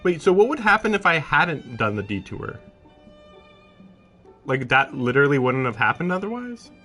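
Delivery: loud, high-pitched disbelief; incredulous reaction. Real, not acted. Player questioning the game's plot, incredulous. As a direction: blurt it out, baffled and loud.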